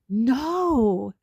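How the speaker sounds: a long, scandalized "no"; agog. A narrator's reading. Parvati hearing juicy gossip from Hermione, loudly agog. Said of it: "looking positively agog".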